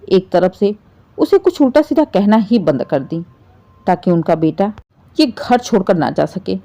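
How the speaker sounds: ordinary delivery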